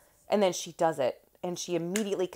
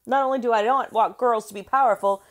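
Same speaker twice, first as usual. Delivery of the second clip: mocking fanboy; 4 semitones higher, 8 dB louder than usual.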